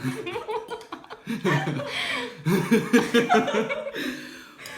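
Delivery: loud pained laugh at the heat; burning laugh. Real, not acted. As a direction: laugh it out as the spice hits. Spicy food just hit.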